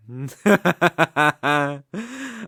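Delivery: loud, gloating villain laugh that falls away; mocking evil laugh. Demon lord laughing at the mediocre sacrifice, gloating. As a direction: laugh down at the helpless human.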